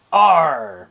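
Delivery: loud pirate arr; pirate growl. Shown as a demonstration. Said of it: "what pirates say when they're angry".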